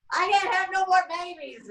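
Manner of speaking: high put-on pioneer woman wail; pioneer lady. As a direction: play the desperate cottage wife. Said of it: "I'm gonna be the lady".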